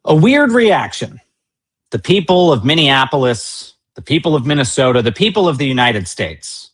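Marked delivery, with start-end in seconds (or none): 0.0-1.2 slow, scornful stress on weird; mocking quote. Host mocking the vice president's phrase, slow and scornful. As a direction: repeat his phrase with slow disbelief. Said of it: "he says".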